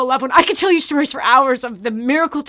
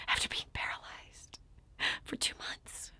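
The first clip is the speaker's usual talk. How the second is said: awed whisper; 20 dB quieter than usual. Real, not acted. Marveling at her own recovery, hushed whisper. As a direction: drop to a near-silent whisper.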